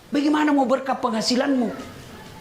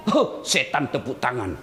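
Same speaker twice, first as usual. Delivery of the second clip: normal talking voice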